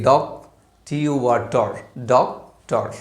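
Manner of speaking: Doctor said very slowly after its parts; slow it right down to show the parts; slow syllable demo. Shown as a demonstration. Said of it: "উচ্চারণ করতে পারি দুইবারে".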